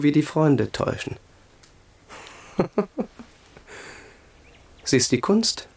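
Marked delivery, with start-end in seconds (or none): 2.6-3.2 kurzes Lachen. Acted. Prince laughs bitterly alone.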